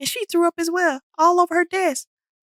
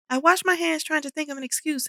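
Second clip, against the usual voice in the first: ordinary delivery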